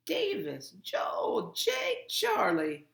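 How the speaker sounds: loud roll call of names; name each child loudly, one by one; gruff grandmother. A narrator's reading. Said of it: "Graham's gruff, familiar voice".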